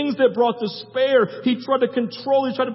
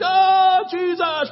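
high, loud cartoon wail to Jesus; mock falling cry; 10 semitones higher, 5 dB louder, much slower than usual. Preacher imitating himself going off the cliff, mock panicked cry. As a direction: cry out as if plunging off a cliff, hammed up.

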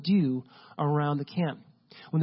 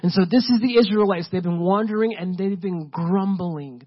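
normal talking voice

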